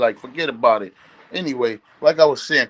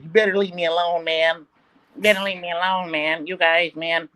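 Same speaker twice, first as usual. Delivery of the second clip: imitating Carmelo; 4 dB quieter than usual. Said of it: "he must has a squeaky voice".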